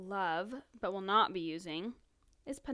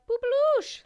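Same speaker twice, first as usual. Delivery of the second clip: high cooing; 10 dB louder, slower than usual. Retold or imitated. Cooing a baby nickname.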